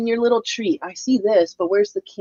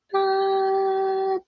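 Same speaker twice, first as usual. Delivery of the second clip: ordinary talk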